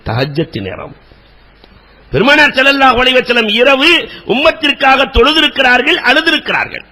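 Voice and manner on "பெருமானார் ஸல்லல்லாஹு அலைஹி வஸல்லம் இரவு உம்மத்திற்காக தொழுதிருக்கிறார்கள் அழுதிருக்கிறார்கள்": fervent preaching. Acted. Preacher urging the congregation to pray and weep, impassioned high preaching cry. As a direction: urge the crowd to weep in prayer.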